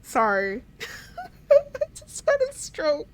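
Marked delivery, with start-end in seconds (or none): 0.8-3.1 high-pitched helpless giggle through the words; laughing. Real, not acted. Gamer laughing at own tongue-twist, high giggle. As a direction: squeak it out while cracking up. Giggling after fumbling a word.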